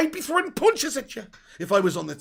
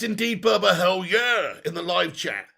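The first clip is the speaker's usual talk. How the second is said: normal talking voice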